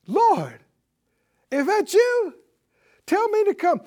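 high-pitched call across the water; Peter. Acted. Acts Peter calling to the Lord.

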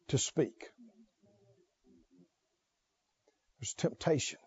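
low, soft, earnest preacher's hush; hushed plea. Real, not acted. Preacher pleading with friends about temptation, hushed and low. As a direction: drop right down and plead quietly.

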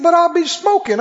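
high-pitched puzzled objection; retold protest. Smoker objecting to the preacher's instructions, high protest. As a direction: imitate a baffled man objecting, pitched well up.

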